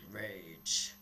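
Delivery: very low, quiet voice; weirdly deep, subdued single word. Real, not acted. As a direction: drop far down into an odd deep register. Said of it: "my voice just went really weird".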